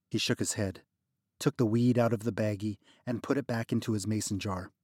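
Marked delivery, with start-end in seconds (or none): none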